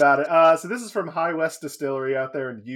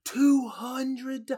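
shocked; 10 semitones higher than usual. Real, not acted. Shocked at the price.

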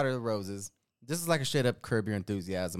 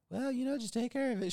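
high-pitched mock-casual voice; imitating wife; 12 semitones higher, 3 dB quieter than usual. Husband mimics wife telling him to fix it, high mock-casual. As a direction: put on a much higher voice for the wife. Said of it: "she made it sound like she was like".

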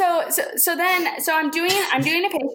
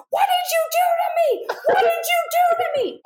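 high-pitched imitation of a patient shrieking; mock screaming. Patient screaming at her therapist in accusation, mock shriek. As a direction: act out an outraged, hammed-up scream. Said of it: "screaming at me".

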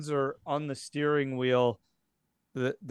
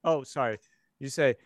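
ordinary delivery

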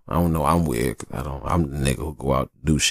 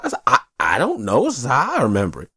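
ordinary delivery

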